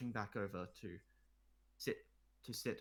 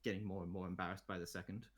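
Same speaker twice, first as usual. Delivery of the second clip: ordinary talk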